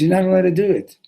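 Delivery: high, loud objection; insistent protest. Acted.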